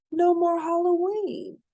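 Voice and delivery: ordinary delivery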